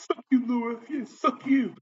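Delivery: very high, lightweight put-on jeer; mock taunt. Imitating himself flipping off the office, high mock taunt. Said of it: "was saying it real loud".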